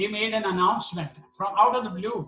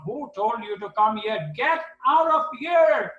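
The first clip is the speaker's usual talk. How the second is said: tiger-like roar; 5 semitones higher, 5 dB louder than usual. Retold or imitated. Doctor roaring at intruders in the hospital, furious shout. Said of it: "that big tiger-like s-, roaring sound".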